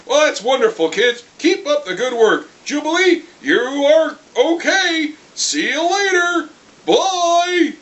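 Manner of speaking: hammed-up kindly mentor; mock cheesy teacher. Cyclops praising the kids' homework, hammed-up sing-song.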